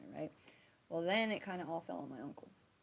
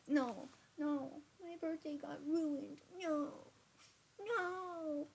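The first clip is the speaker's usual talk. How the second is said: high, whiny mock wail over a ruined birthday; mock despair; 9 semitones higher, much slower than usual. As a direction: wail it like a kid whose party is ruined. Inner panic about ruined birthday.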